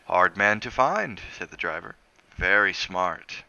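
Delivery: slow, deliberate island driver; slow knowing words. Acted. Driver slowly explaining the fugitive to Crosby, deliberate. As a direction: let each word land slowly, knowingly.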